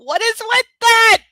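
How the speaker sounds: high, loud, slow disbelief; incredulous. Real, not acted. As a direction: ask it in total disbelief. Incredulous aside.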